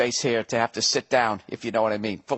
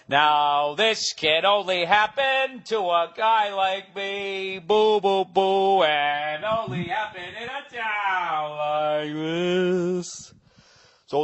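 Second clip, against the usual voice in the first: higher, slow, grandiose announcer-style address; grand speech voice; 6 semitones higher, much slower than usual. Pretend. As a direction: puff it up like a big ceremonial speech. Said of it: "I was gonna come out and be like".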